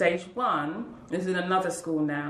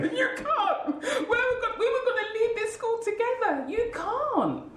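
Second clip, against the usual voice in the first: high, tearful wail, half laughing; pleading through tears, pitch way up; crying out. Retold or imitated. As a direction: voice breaking high into a sob. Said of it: "And I cried".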